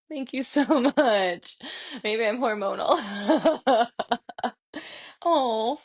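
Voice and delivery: thanks broken by real laughter, drawn out slowly; laughing thanks. Real, not acted. Instructor thanking students for kind notes, laughing. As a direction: laugh through it, slow and touched. Moved by student gratitude messages.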